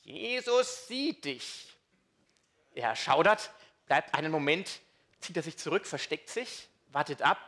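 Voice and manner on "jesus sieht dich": high, very slow eerie parrot voice. Acted. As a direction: drag it out high like a spooky talking bird. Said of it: "Und die Stimme sagt". (hohe, sehr langsame Papageienstimme)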